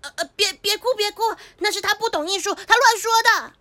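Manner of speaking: high, hurried-sounding panicky reassurance; frantic comforting. Acted. Teacher calming a crying pupil, flustered.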